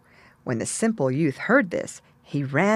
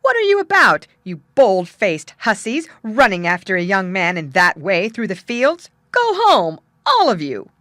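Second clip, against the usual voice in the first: loud, indignant scolding; angry parson; 6 dB louder than usual. A narrator's reading. Parson scolding the girls chasing the young man. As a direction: tell off the shameless girls. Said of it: "he cried out".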